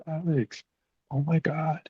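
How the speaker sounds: high, soft "Alex, oh my God" in mock surprise; imitating a surprised gasp. Caseworker spotting her old foster kid, high surprised voice. Caseworker's shocked greeting, retold.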